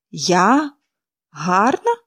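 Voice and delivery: a touch of bitter disbelief; mildly ironic echo; bitter irony. A narrator's reading. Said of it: "з гіркою іронією перепитала".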